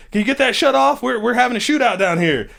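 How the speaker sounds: high, hurried call; urgent teammates. Retold or imitated. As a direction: shout up from a gunfight. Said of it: "They're like".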